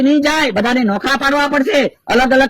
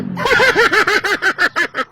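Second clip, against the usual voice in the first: high, eerie laugh of a spirit; a spirit's high wild laugh; possessed laughing fit; 6 semitones higher than usual. Acted. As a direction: cackle like the laughing ghost inside him. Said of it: "ચાલ, દાંત કાઢ".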